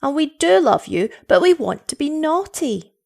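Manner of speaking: put-on high childish insistence; cried out. A narrator's reading. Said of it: "they cried".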